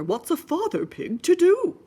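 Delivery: mock fretting daddy; flustered father pig; hammed-up daddy pig. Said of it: "said Piggy's father".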